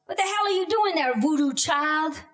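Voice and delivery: mother yelling out the window; sharp, shaming reprimand; scolding mother. Retold or imitated.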